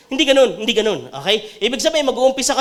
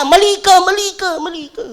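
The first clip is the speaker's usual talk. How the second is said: mocking nag; 5 semitones higher, 5 dB louder than usual. Mocking a nagging Facebook commenter.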